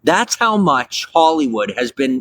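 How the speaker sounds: usual speaking voice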